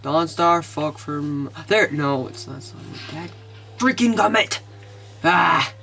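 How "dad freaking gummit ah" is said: frustrated outburst ending in a growled "ah"; freaking out. Real, not acted. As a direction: blurt out a mild curse in exasperation. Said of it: "Sorry, I'm freaking out".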